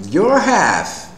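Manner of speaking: Your half with a broad open A, up high; British pronunciation. Shown as a demonstration. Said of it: "o britânico fala half".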